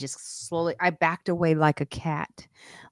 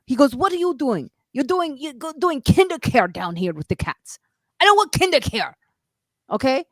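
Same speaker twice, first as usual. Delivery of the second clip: much higher, indignant tirade; the super defending her, pitched way up; bold scolding; 8 semitones higher than usual. Retold or imitated. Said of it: "he got really bold".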